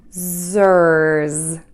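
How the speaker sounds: "Zers" drawn out, buzzing Z at each end; Z-er-Z syllable. Shown as a demonstration. Teacher puts the syllable together, slow buzzed "Zers". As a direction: stretch "Zers" through Z, er and Z. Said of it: "end by pulling your lips back for the Z".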